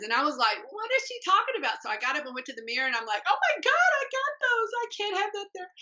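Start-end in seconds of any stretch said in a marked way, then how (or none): 0.7-1.8 much higher, fast baffled question; a bewildered viewer thinking aloud. Retold or imitated. Baffled by TV fitness slogan. 3.3-5.7 much higher, horrified squeal at the mirror; shocked discovery, hammed up; mock horror. Spots her thighs in mirror.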